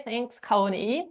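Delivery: ordinary delivery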